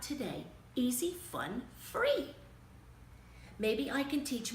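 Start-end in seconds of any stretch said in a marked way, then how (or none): 1.8-2.3 ad squeal. Acted. Ad slogan punchline.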